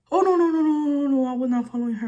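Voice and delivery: high, loud mock protest, easing off; frantic denial. Man caught following her denies it to her brother, mock frantic protest. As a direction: imitate a flustered string of denials. Retold follower hastily denying it.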